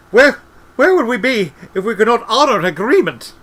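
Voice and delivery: high, loud, stammered outcry; frantic protest. A narrator's reading. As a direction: shout the question in desperation. Albert frantic as he is threatened.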